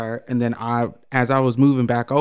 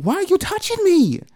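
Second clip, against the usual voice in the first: high, outraged squeal of a question; appalled, recoiling from the touch; indignant outrage. Retold or imitated. To the salesman hugging her, high outraged disbelief. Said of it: "retold outrage at a stranger".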